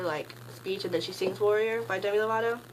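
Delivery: ordinary delivery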